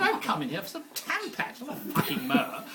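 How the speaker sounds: laughing. Real, not acted. Angry customer line, laughing.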